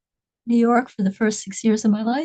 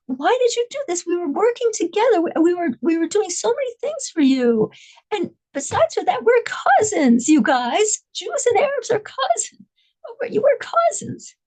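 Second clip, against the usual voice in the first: much higher, imploring plea; pleading hostage; 8 semitones higher than usual. Retold or imitated. Hostage reminding her captor they are cousins, much higher and imploring. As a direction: beg him to remember you're cousins.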